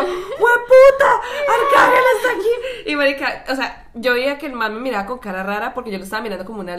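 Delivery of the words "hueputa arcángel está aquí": high, slow, laughing shout; excited shout. Retold or imitated. Fan seeing the star onstage, loud excited shout. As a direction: scream it in disbelief, laughing.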